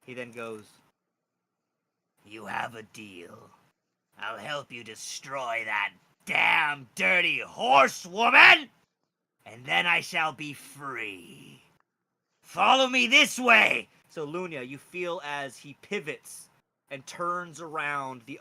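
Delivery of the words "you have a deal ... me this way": slow, loud rough dragon voice. Acted. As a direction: boom it out like a coarse young dragon. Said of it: "You can hear from his voice".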